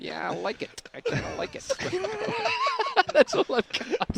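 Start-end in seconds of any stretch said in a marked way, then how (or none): 0.0-1.6 higher put-on Canadian impression; Blues Brothers. Comedian slips into film character, higher put-on voice. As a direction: pitch up into a comic film impression. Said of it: "I'm totally Blues Brothers".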